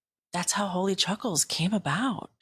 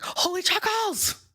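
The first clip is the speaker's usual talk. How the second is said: much higher, thrilled announcement; excited announcement; 12 semitones higher than usual. Retold or imitated. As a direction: squeal the name to your friend. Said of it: "I called Nisha and I'm like".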